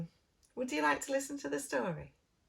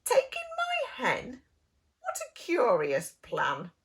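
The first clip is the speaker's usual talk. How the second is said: disbelieving squeak at the idea; astonished old lady; 5 dB louder than usual. Acted.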